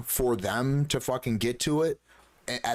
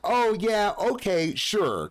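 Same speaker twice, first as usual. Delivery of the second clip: sarcastic; 7 semitones higher, slower than usual. Real, not acted. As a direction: throw it back with sarcasm. Sarcastic retort to ex.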